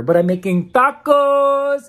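high, loud, drawn-out excited shout. Real, not acted. Vlogger announcing tacos with loud excitement. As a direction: belt it out with glee.

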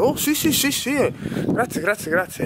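high, eager yes yes thank you; excited thanks. Retold or imitated. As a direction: gush it out gratefully. Host's eager thanks quoted.

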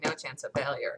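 "No chance of failure" said softly, quoting himself; quiet self-quote. Retold or imitated. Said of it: "every single time I say".

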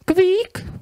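high-pitched piggy squeak; one sustained, very high oink-like squeal. Retold or imitated. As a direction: squeal it like a pig. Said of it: "Podobně povídá prostý prase".

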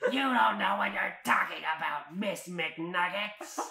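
nasal, loud, high retort; hammed-up nasal villain voice; Rita. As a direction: taunt loudly, stretching the final name.

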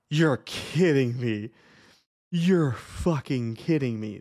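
slow, drawn-out exasperated disbelief; frustrated disbelief. Retold or imitated. To the airline agent, stranded, slow frustrated disbelief. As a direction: drag each word out in fed-up frustration. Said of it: "I'm going to sound frustrated in retelling the story".

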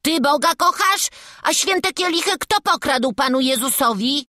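put-on high, loud accusing boy; indignant high-pitched shout; Wawrzuś yelling. A narrator's reading. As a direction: hurl an outraged question. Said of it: "wrzasnął Wawrzuś".

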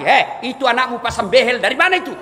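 acted angry cross-examination; accusing interrogation. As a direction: grill the suspect, building in volume. Interrogator accuses the rich man.

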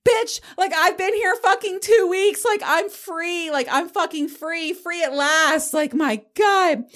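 high loud triumphant cry of freedom; ecstatic yell. Retold or imitated. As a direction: yell it like you're finally free. Shouting joy in the ocean.

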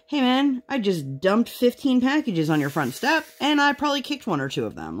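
high mock-casual dude voice; mock bro voice, high and breezy; careless delivery guy. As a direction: toss it off like a lazy courier. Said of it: "Not like".

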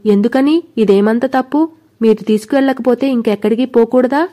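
ordinary delivery

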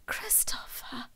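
a faint, pained whisper of his name; wife calling her husband softly in pain. A narrator's reading. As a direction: call him weakly through the pain. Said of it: "mit schmerzerfüllter Stimme".

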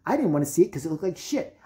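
ordinary talk

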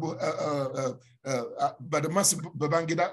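ordinary delivery

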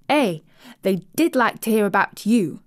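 lightly voiced Yorkshire housemaid, chatty and pleased; broad Yorkshire vowels, friendly and eager; Yorkshire maid. Maid telling the little girl about home, light Yorkshire accent. Martha's Yorkshire dialect speech.

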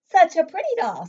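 high, loud, admiring baker; baker's voice. A narrator's reading. Baker admiring a little girl's doll, high and delighted. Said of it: "said the baker".

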